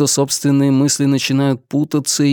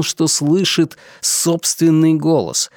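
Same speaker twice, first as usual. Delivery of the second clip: ordinary delivery, nothing marked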